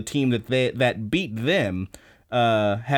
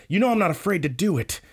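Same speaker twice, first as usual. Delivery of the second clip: mock dramatic line; 7 semitones higher, faster than usual. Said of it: "he says".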